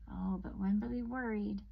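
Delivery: plain reading voice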